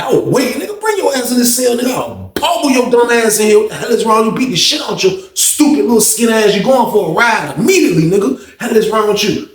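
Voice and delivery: high-pitched heated threats; re-enacted furious tirade; acted angry threat.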